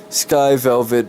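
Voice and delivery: usual speaking voice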